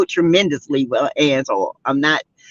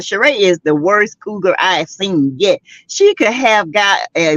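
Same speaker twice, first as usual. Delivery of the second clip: ordinary talk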